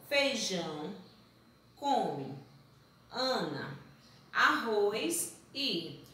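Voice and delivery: "Feijão... come... Ana... arroz e", long pause after each word; slow, separated word list. Shown as a demonstration. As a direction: read the scrambled words one at a time. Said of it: "Tá vendo como fica sem sentido".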